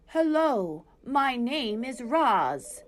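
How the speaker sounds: slow, even robot voice; robot speaking to animals for the first time; robot greeting. A narrator's reading. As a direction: introduce yourself slowly, evenly.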